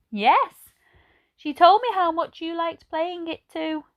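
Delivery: put-on high, slow kindly old lady. A narrator's reading. Narrator voices old Edna greeting Ben, high and slow. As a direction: voice her like a gentle elderly lady. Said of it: "said Edna".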